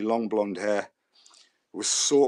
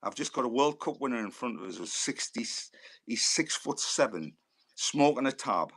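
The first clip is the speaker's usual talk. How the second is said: ordinary talk, nothing marked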